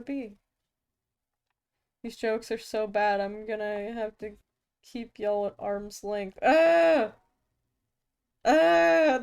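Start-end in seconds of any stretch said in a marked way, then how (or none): 6.4-9.2 two loud high yelps; pained yelps. Real, not acted. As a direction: yelp as if wounded by the puns. Reacting to arm puns.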